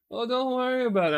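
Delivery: high, dismissive brush-off; imitated wife. Wife brushing off husband, high imitation.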